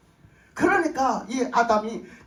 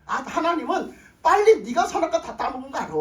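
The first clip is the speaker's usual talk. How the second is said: ordinary talk, nothing marked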